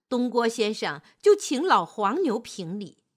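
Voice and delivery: ordinary delivery, nothing marked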